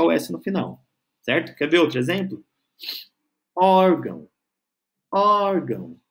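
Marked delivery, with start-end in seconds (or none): 3.6-4.3 "Órgão" with a long, emphatic Ó; stressed first syllable. Shown as a demonstration. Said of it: "Ó é a sílaba tônica". 5.1-6.0 "Órgão" with a high, held Ó; teacher's drawn-out Ó on "Órgão"; stressed first syllable. Shown as a demonstration. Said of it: "Ó é a sílaba tônica".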